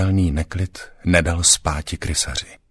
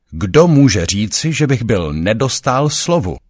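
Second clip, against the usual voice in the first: raised, louder indignant question; forced protest; 6 semitones higher, 6 dB louder than usual. A narrator's reading. Councillor Štrum protests to the smiling ratcatcher, forced and loud. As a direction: challenge him with pitch pushed high. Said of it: "rozhovořil se Štrum takořka násilím".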